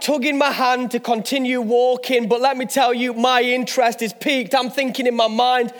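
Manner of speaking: ordinary talk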